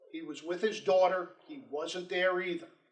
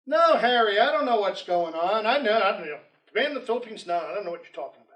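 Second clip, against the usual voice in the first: dismissive brush-off; 4 semitones higher, 11 dB louder, faster than usual. Retold or imitated. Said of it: "he could be dismissive".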